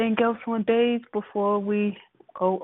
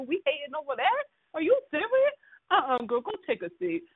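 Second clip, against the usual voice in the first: scoffing disbelief; 6 semitones higher than usual. Retold or imitated. Scoffing at Lee's grudge.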